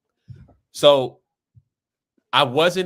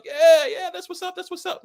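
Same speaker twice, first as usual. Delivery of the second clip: hyped crowd; 5 dB louder than usual. Retold or imitated. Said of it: "And the crowd's like".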